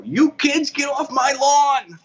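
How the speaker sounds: put-on grumpy old man yelling, Boston accent. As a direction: yell it high in a thick Boston accent. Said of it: "In the Boston accent".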